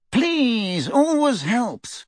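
slow, high, sneering drawl; cold snide voice. A narrator's reading. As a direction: sneer it slowly and coldly. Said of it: "a cold, snide voice".

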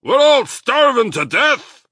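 loud, high-pitched alarm; excited. A narrator's reading. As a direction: pour out the bad news at full pitch. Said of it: "Badger said excitedly".

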